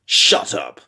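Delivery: low, loud curt order. Acted. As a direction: bark it curtly. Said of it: "he bade her curtly".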